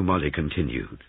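plain reading voice

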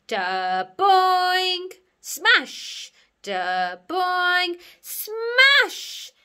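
loud, high cartoon boing and smash; imitated bouncing and smashing noise. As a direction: boom out the crashing noises. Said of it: "heard a noise".

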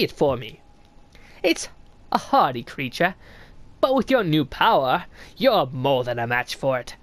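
lightly voiced sly old snail, curious; a hint of a creaky old mystic greeting a stranger; snail shaman voice. Streamer reading the shaman's greeting to the knight, lightly voiced old mystic.

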